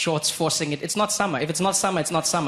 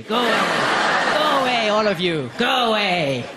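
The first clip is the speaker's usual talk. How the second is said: high, loud snooty shouting; posh shout; 6 semitones higher, 5 dB louder than usual. Retold or imitated. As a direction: shoo the poor people away. Rich rowers shooing poor.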